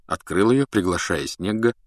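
plain reading voice

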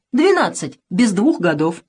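«Двенадцать» резко выше, «двух» с нажимом; упрямо настаивать, выделяя «Двенадцать»; упрямое ударение. A narrator's reading. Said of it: "твердит мальчишка". (мальчик упрямо стоит на своём, с нажимом)

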